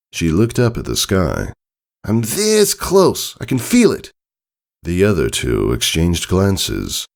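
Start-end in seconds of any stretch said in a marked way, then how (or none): 2.0-4.1 high filly voice, slow and intent; Scootaloo, determined. Acted.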